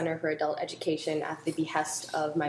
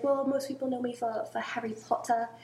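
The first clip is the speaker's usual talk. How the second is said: a light British J K Rowling voice; British accent; 4 semitones higher than usual. Retold or imitated. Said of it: "could sort of do a British accent".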